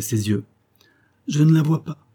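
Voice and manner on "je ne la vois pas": higher-pitched, mildly emotional reply; a touch of halting emotion, pitched up; choked with emotion. A narrator's reading. Uncle at the window to the colonel, mildly choked with emotion. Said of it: "d'une voix saccadée par l'émotion".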